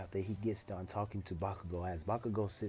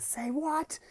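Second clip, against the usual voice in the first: high, loud disbelieving shout; outraged yell; 5 dB louder than usual. Acted. Bakugo hearing Deku is stronger, outraged shouting.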